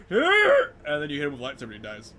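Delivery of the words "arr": high, loud mock ghost cry; ghost roar. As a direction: let out a hammy ghost roar. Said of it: "His ghost, like".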